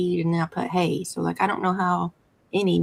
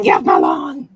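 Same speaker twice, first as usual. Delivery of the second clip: louder grumpy get-off-my-lawn; cranky yell; 5 dB louder than usual. Retold or imitated. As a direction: bark it like a cranky old man. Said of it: "yelling at the squirrels".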